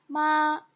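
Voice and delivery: माँ held high and level; first-tone माँ, long with no bend; flat first tone. Shown as a demonstration. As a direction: sustain माँ on one straight pitch. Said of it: "first tone की जो pronunciation".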